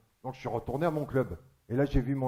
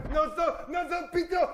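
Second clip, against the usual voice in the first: high, insistent repeated cry; mock frenzy. Comic imitating a man working himself up, frenzied. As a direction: get worked up all alone, pitched way up. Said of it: "Il s'excitait tout seul".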